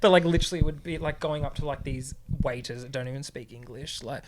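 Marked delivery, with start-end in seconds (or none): none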